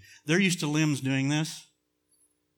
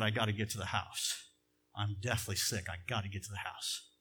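low, quiet, understated self-talk; retold weak thought, kept soft and low; sick inner thought; 4 semitones lower, 6 dB quieter than usual. Preacher recalling his thought of getting home sick, quiet and low.

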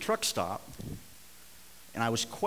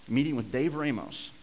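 ordinary talk, nothing marked

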